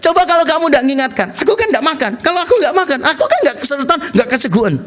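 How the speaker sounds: high, fast comic complaint; mock angry. Man blames girlfriend for reminding him to eat, mock angry. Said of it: "Bisa marah loh gara-gara diingatkan".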